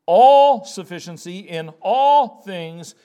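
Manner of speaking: each "all" punched and drawn out; slow down, land hard on every "all"; stress on all. Shown as a demonstration. Preacher making sure listeners catch the word, each "all" heavily stressed. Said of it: "Did you catch the word all?".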